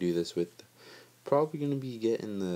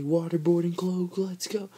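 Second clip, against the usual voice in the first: much higher, loud victory cry; excited cheer; 10 semitones higher, 5 dB louder than usual. Retold or imitated. Retelling his win to viewers, high excited cheer. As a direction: celebrate like you just won. Celebrating after first-try win.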